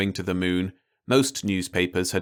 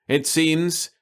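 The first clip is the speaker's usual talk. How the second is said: higher voice of a scientist addressing the world; scientist announcing; 9 semitones higher, 3 dB louder than usual. Acted.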